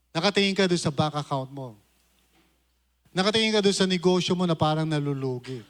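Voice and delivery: ordinary talk, nothing marked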